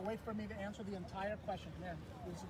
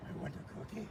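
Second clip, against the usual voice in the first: Cookie Monster voice; 6 semitones lower, faster than usual. Pretend. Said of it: "Can you do the Cookie Monster voice?".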